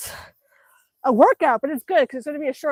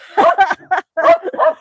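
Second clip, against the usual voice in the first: loud high laugh; 10 semitones higher, 9 dB louder than usual. Real, not acted. Woman laughing at her puppy's antics, loud laugh.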